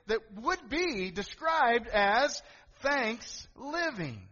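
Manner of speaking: fervent preacher; preaching. Acted. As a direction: lift the congregation slowly. Preaching builds up.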